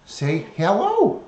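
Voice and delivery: high, slow sing-song coaxing; coaxing pet voice. Acted. Coaxing the parrot to greet viewers, slow high sing-song. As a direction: draw it out sweetly to coax the bird.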